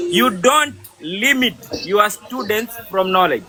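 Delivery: slowly and deliberately, word by word; slow motion. Real, not acted. Trainer repeating his point to a colleague, in slow motion. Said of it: "I will repeat this slow motion".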